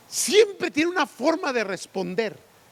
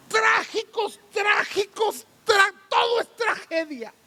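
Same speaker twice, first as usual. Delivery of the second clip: higher, mock wailing over tragedy; hammed-up lament; 7 semitones higher than usual. Preacher mocks the tragic ones in his church, hammed-up wail. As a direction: ham up a melodramatic sob.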